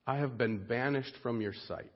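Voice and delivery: low, hushed lament; quiet despair. Acted. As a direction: drop right down, as one cast out. Jonah's despairing prayer, acted.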